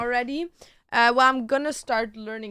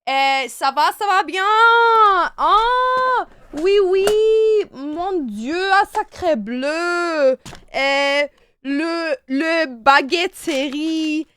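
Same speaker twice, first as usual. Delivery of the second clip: hammed-up mock French accent, louder; french chef; 3 semitones higher, 9 dB louder than usual. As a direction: ham up a fake French accent. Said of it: "I'm just making words up".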